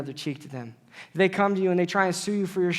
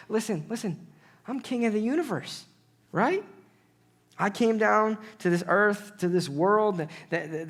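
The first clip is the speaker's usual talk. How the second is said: ordinary talk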